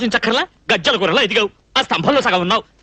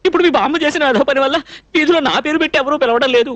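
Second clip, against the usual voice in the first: normal talking voice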